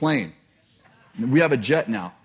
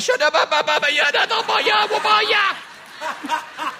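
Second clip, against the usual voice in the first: very high hysterical gibberish, stretched out at the end; panicked babbling; slower than usual. Acted. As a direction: wail nonsense in a frantic panic. Acting his own panicked babble.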